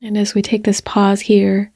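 unhurried meditation voice with long pauses; guided meditation. Real, not acted. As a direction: gently guide listeners into their bodies, leaving space between phrases.

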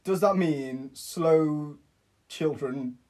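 very slow, word-by-word guess; halting guess. Real, not acted. Friend guesses what the Welsh means, slow and halting. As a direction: drag it out with pauses between words.

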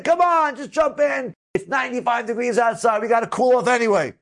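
high, eager coaxing; urging cheer. Retold or imitated. Friend coaxing him into the cold mikveh, high eager urging. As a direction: egg your friend on to jump.

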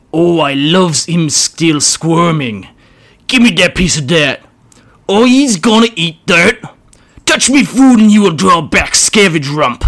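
high, loud, squeaky goblin bickering; squabbling grot. Acted. Grots fighting over scraps, shrill squabble. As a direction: snarl like a greedy little goblin.

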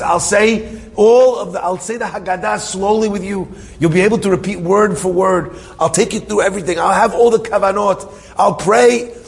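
high-pitched, urgent string of promises; pleading. Retold or imitated. Friend promises prayers to get the matzah, pleading. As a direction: act out desperate bargaining. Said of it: "He's begging, pleading".

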